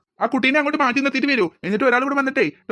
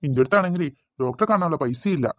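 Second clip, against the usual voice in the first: put-on old man; 5 semitones lower than usual. Old uncle begs the pharmacist for pressure pills, low and pleading.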